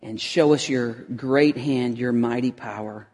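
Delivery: hushed closing prayer. Acted. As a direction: pray it quietly and humbly. Opening prayer.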